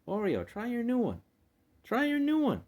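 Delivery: high, singsong coaxing; coaxing pet talk. Real, not acted. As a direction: coax a cat in a high voice. Coaxing the cat to scratcher.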